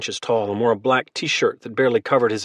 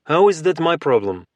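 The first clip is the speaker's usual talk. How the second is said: put-on Russian accent; 4 semitones higher, 3 dB louder than usual. A narrator's reading. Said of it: "the mountain spoke again".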